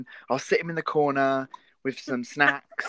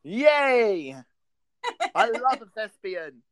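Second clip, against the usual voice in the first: high, loud happy cheer; excited cheer; 5 semitones higher, 7 dB louder, much slower than usual. Real, not acted. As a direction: cheer it out with delight. Cheering for a thespian.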